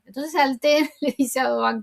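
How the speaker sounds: ordinary delivery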